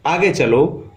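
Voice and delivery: usual speaking voice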